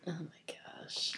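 soft whispered gasp. Real, not acted. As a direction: whisper in disbelief. Whispered reaction.

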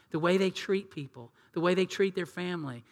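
ordinary talk, nothing marked

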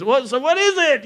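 high-pitched mock pilot query; impatient pilot. Pilot asking if she said yes, mock impatient.

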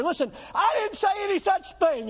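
pleading it with a strained voice; mock self-defence. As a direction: cry out defensively as if accused. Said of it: "and losing my voice saying it".

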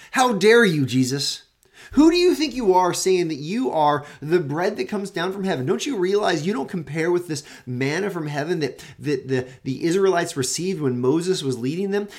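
loud, high affronted rebuke, easing toward the end; indignant grumblers. Acted. Grumblers challenging Jesus over the bread claim, indignant. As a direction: take him to task, bursting out loud. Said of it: "layman's translation".

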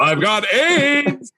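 high squeaky joke voice, slow; squeaky falsetto mockery. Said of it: "walking around a steam room ... going".

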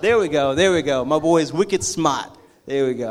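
put-on loud, rough stab at a Boston accent. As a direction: imitate a proud Boston buddy, loudly. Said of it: "Boston accent".

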